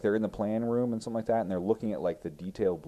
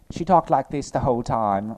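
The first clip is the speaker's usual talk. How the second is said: mock British accent, high and loud; hammed-up imitation of a terrible British accent; bad British accent; 7 semitones higher, 9 dB louder than usual. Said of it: "British accent".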